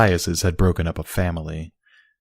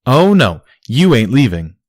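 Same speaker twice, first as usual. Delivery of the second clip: loud, higher sharp comeback; retort; 5 semitones higher, 7 dB louder than usual. Acted. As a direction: fire it right back at him. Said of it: "Barry retorted".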